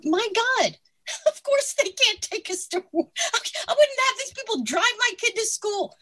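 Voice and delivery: high, laughing outburst of disbelief. Real, not acted. Guest laughing at leaders taking us to war. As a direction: scoff at the idea with a laugh.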